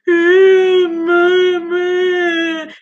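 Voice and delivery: high, loud whiny fake wail of "mama"; fake crying. Boy Roger fake crying to his mother, high whine. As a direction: wail like a kid faking tears. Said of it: "fake ass crying".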